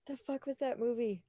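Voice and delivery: ordinary talk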